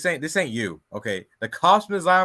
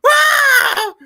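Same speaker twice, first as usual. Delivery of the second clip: high mock scream, loud; imitated little scream; 12 dB louder than usual. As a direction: copy her little scream. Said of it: "that little scream that she does".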